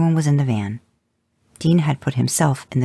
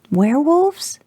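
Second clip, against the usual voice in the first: high, bright eager question; a boy thrilled to guess the monster; eager kid guess; 3 dB louder, slower than usual. Acted. Son eagerly guessing monsters in the car, high and thrilled.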